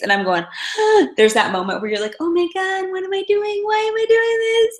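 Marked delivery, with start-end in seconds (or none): 0.5-1.1 sharp high gasp of shock; mock horrified gasp. Realizing he's starving. 2.2-4.8 high, squeaky mock panic; horrified self-talk, pitched way up; panicked inner voice. Inner panic over the penny.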